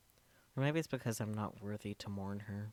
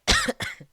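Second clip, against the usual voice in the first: cough; 18 dB louder than usual. Real, not acted. Loud cough in room.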